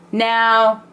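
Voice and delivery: normal talking voice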